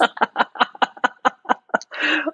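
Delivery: high delighted laugh bursting out; real burst of laughter; big laugh. Real, not acted. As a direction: laugh in happy disbelief. Laughing at keynote surprise.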